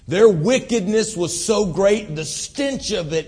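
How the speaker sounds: loud, slow preaching. Acted. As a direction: bear down on it like a preacher. Preaching at full force.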